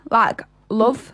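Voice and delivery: "love" with the short oo of "put"; Northern "love". Shown as a demonstration. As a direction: say love like "luv", Northern English. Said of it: "I pronounce love like L U V".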